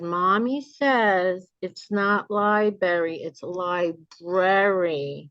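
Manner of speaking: "library" slow and careful with both r's; a slow child's correction, every syllable spelled out; child carefully correcting "library"; little girl correcting. Retold or imitated. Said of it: "the little girl said".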